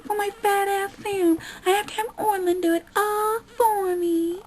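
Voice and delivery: mock a lazy whiner; whiny mocking impression. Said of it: "I don't get lazy and go".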